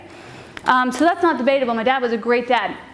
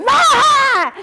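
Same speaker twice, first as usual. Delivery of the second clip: loud, high monster yell; retold scare scream; 13 semitones higher, 6 dB louder than usual. Little brother jumping out at passersby, loud high scream. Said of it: "Jimmy goes".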